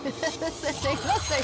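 slow, high mock offense; mock indignant. Real, not acted. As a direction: pretend to be hurt, slowly. Mock offended after laughter.

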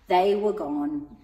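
plain reading voice

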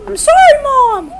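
very high, loud falsetto wail; over-the-top imitation of a busted kid; mock apology to mom. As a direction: squeal a hammed-up apology. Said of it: "that's basically how the conversation went".

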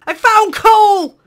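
high, loud, slow triumphant cry; real delighted yell; overjoyed shout. Real, not acted. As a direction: celebrate like a treasure find. Finally finds coal.

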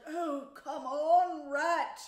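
loud, slow, whining plea with a cry in it; impatient. A narrator's reading. Mole urges busy rat on, impatiently. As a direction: draw it out, fed up with waiting. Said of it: "while the mole waited impatiently".

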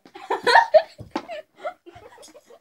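shrieking high laugh; high squealing laugh. Real, not acted. Tasting a gross jelly bean.

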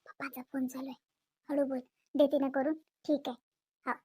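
low, soft voice; hushed. Acted. Mother hushing child during Papa's phone call, hushed. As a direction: keep it down, low and discreet.

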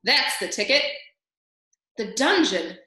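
loud jeering cheer; shouted. A narrator's reading. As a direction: shout approval from the crowd. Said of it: "shouted a mouse in the crowd".

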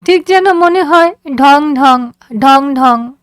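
put-on high girl's voice, stretching the words; drawn out. A narrator's reading. Daughter to father mimicking the bell, high and drawn out. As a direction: drag the words out playfully. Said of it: "ও টেনে টেনে বলল".

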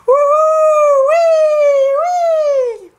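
very high sledding cries; joyful whoops. Acted. Kids racing down the hill, whooping.

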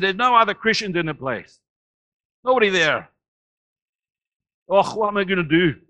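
loud, high-pitched broad Scots; broad Scottish accent. Retold or imitated. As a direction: imitate a Scotsman protesting in thick Scottish. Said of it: "he speaks in this Scottish accent".

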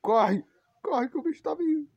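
high panicked yell; being chased. Real, not acted. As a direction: shout a frantic warning. Creature approaching again.

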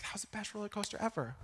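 fast breathy whisper; sister's delight, whispered fast; whispered quote. Retold or imitated. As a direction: whisper it quickly like a thrilled secret. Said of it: "She was like".

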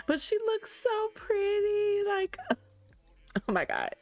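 high, slow, squealy gushing. Real, not acted. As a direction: coo over how pretty she looks. Gushing over grown-up Sim daughter.

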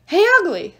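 loud, high call to get attention; taunting call. A narrator's reading. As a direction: holler a taunt at the enemy. Percy calls out to the giant.

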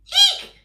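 very high, loud scream of eek; shrieking eek. Acted. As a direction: shriek in fright. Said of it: "shouted the elephant".

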